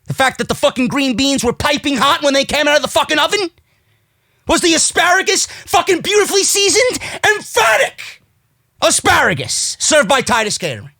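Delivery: high-pitched, escalating mock questions; sarcastic rant. Real, not acted. Host mocking "emphatic" through catering food, sarcastic. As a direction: pile up absurd questions with mounting scorn.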